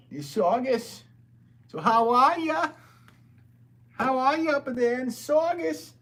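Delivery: put-on loud, high, slow Boston accent; hammed-up Boston accent, hollered high; Bill Burr. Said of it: "That's the Bill Burr impression I can do".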